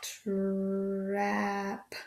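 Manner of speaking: "Trap," stretched soft; slow blend. Shown as a demonstration. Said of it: "Let's try that again".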